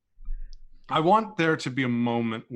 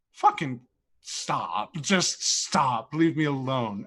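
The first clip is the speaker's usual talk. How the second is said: loud, exasperated snap: stop, just stop; a character finally losing his patience; fed-up outburst; 4 dB louder, slower than usual. Retold or imitated. Voicing Simon's outburst in roleplay.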